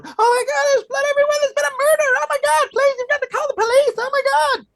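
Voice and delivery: hysterical screaming woman. Acted. Hotel guest screaming to the front desk about a murder, hysterical shriek. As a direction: scream it into the phone, completely panicked. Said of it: "hysterical woman screaming at him into the phone".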